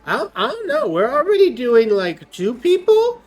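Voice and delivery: loud, high tipsy call-out; tipsy barmaid. Acted. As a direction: call out to the room, half drunk. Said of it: "calls out to the other people and says".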